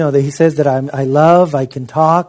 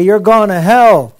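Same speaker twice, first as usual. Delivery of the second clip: louder, slower and higher accusation; condemning; 5 semitones higher, 6 dB louder than usual. Retold or imitated. Christians pointing fingers at a woman, slow loud accusation.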